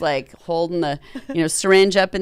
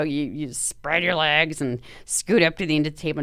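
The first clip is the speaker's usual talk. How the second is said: ordinary delivery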